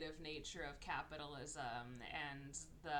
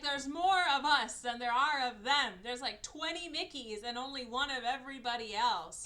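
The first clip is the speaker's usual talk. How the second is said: rallying Mickey clone; 8 semitones higher, 13 dB louder than usual. Retold or imitated. Mickeys voiced plotting together.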